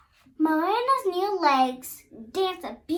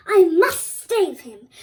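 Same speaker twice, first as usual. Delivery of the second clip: determined thought; 12 dB louder, slower than usual. A narrator's reading. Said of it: "the little mermaid thought".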